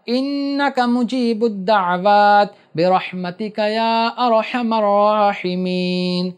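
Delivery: slow, measured Arabic recitation of the dua; slow supplication recitation. Shown as a demonstration. Teacher recites the closing dua for students, slow reverent recitation. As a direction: recite the dua slowly and clearly. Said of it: "سنو غور سے".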